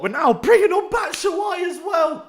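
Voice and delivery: high, loud, drawn-out call; slow, cheering shout at the sub; excited shout. Real, not acted. Fan hails substitute, shouting.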